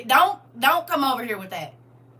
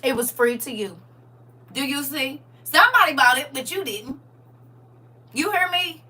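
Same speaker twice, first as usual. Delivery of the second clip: normal talking voice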